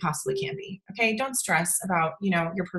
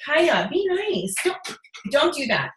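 real scolding; 4 semitones higher, slower than usual. Real, not acted. Breaking off a livestream to correct Kaya, scolding. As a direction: draw out the reprimand, pitch raised.

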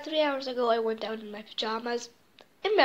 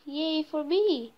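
usual speaking voice

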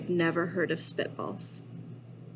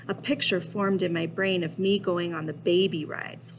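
ordinary delivery, nothing marked

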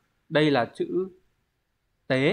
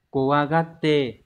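slow reading model; 2 semitones higher, 4 dB louder, much slower than usual. Shown as a demonstration. Teacher models Japanese reading.

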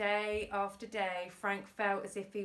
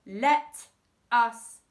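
loud, separated words, raised pitch; forceful demand; 11 dB louder, much slower than usual. A narrator's reading. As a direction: order them firmly out of your way. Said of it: "demanded Auntie Flip".

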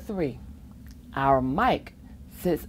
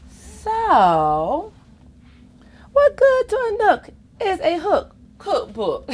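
laughing read; 12 semitones higher, 4 dB louder than usual. Real, not acted. Laughing through rhyme.

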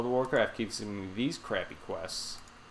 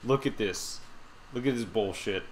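normal talking voice

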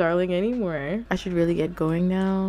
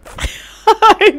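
loud burst of laughter; 14 dB louder than usual. Real, not acted. Laughing at her sim.

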